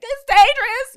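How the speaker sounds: high, loud warning holler. Shown as a demonstration. Mom hollering a danger cue at her son, loud. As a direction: holler it as a sharp warning to a toddler. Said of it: "I might holler that".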